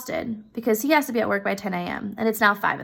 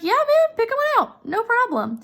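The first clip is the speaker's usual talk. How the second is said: breezy generous friend; 12 semitones higher than usual. Retold or imitated. You offering your closet to a friend, high and breezy. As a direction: toss it off like it's no big deal. You voiced in hypothetical scene.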